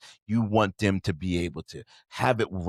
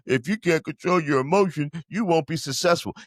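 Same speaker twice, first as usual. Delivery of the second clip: mocking critics; 8 semitones higher, 3 dB louder than usual.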